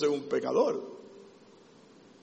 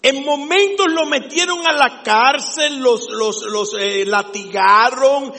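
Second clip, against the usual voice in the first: loud, higher preacher's cry; fiery preaching; 7 semitones higher, 9 dB louder than usual. Acted. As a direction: preach it with full fire to the congregation. Preaching at full force. (predicación fervorosa a plena voz)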